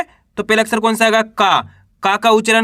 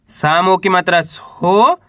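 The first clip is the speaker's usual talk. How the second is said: drawn-out syllable, rising on सो; slow sounding out. Shown as a demonstration. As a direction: stretch it slowly like a phonics drill. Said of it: "मिलाएंगे तो Soviet". (सो पर सुर उठाकर धीरे से बोलना)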